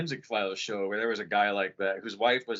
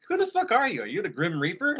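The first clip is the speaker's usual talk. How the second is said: very high-pitched mock outrage; incredulous; 8 semitones higher than usual. Man questioning a morbid stranger, high incredulous retort. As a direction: squeal it up high, challenging the stranger. Retells his alarmed reply to stranger.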